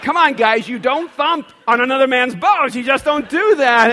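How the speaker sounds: loud, high comic protest; exaggerated indignant telling-off; mock outrage. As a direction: protest indignantly at full voice, for laughs. Mock outrage at being thumped.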